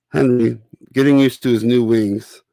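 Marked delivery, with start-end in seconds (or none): none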